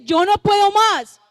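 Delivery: high, loud, drawn-out cry; anguished plea. Acted. Preacher repeats the afflicted believer's cry to God, anguished. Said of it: "de ese angustiado".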